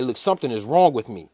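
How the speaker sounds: low, soft retold plea; downplayed alarm in a low voice; understated. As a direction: tell them something is wrong, low and quietly. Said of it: "like, I'm really, like, just like".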